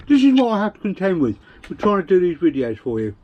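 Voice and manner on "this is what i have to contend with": laughing, fast and loud. Real, not acted. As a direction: laugh the words out. Laughing at family interrupting.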